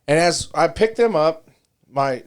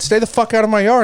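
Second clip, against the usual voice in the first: admitted retold yell; 9 semitones higher, 4 dB louder than usual. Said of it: "yeah, I said".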